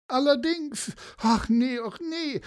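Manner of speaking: put-on very high lament of an old lady; wailing. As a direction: whimper it high, in dismay. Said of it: "jammerte sie". (hohes, klagendes Jammern)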